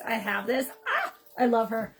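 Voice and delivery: ordinary delivery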